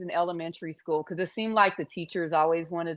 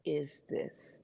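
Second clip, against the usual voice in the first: slow, soft whisper of bafflement; hushed, bewildered aside; whispered confusion; 3 semitones lower, 7 dB quieter, much slower than usual. Retold or imitated. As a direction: whisper it, puzzled. Voicing the baby's confusion.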